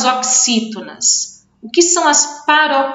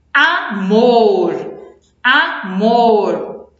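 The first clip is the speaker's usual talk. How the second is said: amor split slowly, long strong MOR; slow teaching demo of amor, A then MOOR; stress on last syllable; much slower than usual. Shown as a demonstration. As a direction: land hard on MOR and hold it. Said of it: "é o mais forte e é a última sílaba".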